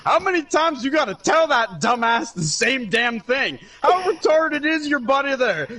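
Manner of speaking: mock grumpy dad scolding from the kitchen; imitating his dad. Dad leaning in from kitchen mocking son's friend, grumbling. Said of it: "my dad's head pops in from the kitchen".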